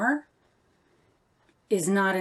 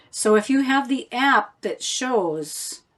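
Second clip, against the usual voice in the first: ordinary delivery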